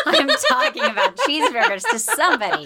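high laughing glee; excited. Retold or imitated. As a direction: burst with delight. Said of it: "I'm like".

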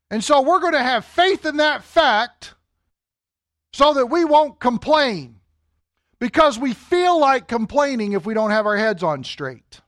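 high, loud, slow mock-pious voice of the people; mock pious crowd. Preacher voicing the grumbling Israelites in a high, loud mock-pious tone. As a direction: ham up the people's fake faith.

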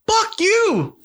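slow, high, drawn-out curse; angry outburst. Real, not acted. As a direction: spit it out slowly, pitched high. Angry outburst while reliving.

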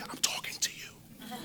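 unvoiced whisper of the Spirit; hushed voice of the Holy Spirit. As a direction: breathe it like a secret in the ear. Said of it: "He just whispered in my ear".

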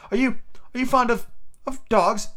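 put-on high, halting girlish question; stammering storybook heroine; hesitant Alice. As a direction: ask nervously in a high voice. Alice hastily changing subject.

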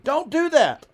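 sharp, high, loud parental shout; yelled warning. Retold or imitated. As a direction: yell it at a child reaching for danger. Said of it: "he yelled at the kid".

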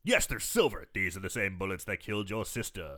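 solemn impression, dropping low and soft; Christopher Lee. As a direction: intone it like a grave horror-film elder. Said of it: "you're doing a good Christopher Lee".

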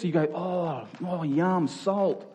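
usual speaking voice

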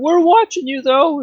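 high, loud cartoon guard voice; watchful guards. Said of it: "they're like".

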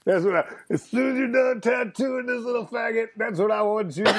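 high, worked-up imitation of a bully; Carl. Bully ordering the tattooist to do him next, insistent. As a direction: push it high and insistent, drawing out the slogan. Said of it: "I have to quote Carl".

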